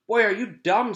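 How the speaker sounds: high, loud scornful taunt; know-it-all girl mocking a classmate; scornful jeer. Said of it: "said Jenny".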